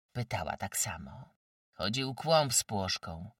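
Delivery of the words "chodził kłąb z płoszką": plain reading voice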